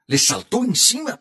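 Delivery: aguda y alarmada, voz de niña; niña asustada. A narrator's reading. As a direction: pregunta con susto infantil. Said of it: "preguntó Estefanía". (niña asustada pregunta al abuelo, voz aguda)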